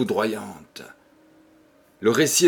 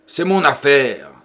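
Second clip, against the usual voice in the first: louder, forceful cry; fierce exclamation; 3 semitones higher, 6 dB louder than usual. Acted. Husband silencing the priest, a fierce loud cry. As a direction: fling the words out with dreadful energy. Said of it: "s'écria Clément avec une énergie effroyable".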